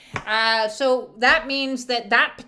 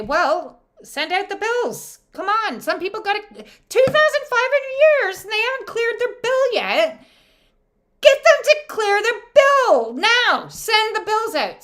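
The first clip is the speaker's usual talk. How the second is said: squeaky high, loud office boss; high bossy clerk voice; 10 semitones higher, 7 dB louder than usual. Pretend. Heavenly accountant voice, high and loud.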